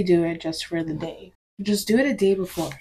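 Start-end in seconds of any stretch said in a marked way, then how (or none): none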